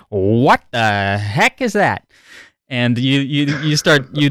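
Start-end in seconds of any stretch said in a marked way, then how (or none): 0.1-2.0 slow, baffled wondering; drawn-out mock bafflement; puzzled. Puzzled reaction to strange bugs.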